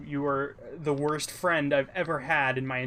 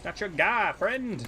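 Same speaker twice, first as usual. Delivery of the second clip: playful mock Canadian, pitched up; Canadian accent; 5 semitones higher, slower than usual. Continuing the South Park loop, mock Canadian accent. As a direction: keep the South Park Canadian bit going. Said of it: "it's a Canadian voice".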